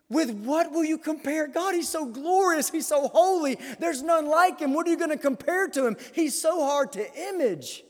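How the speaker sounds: high, insistent pulpit voice; fervent preaching. Real, not acted. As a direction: press each phrase home with fervour. Preaches with fervour.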